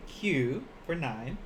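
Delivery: Q spoken slowly as one syllable, kyuu; slow model pronunciation. Shown as a demonstration. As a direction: spell out Q for learners, slowly. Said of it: "we have Q".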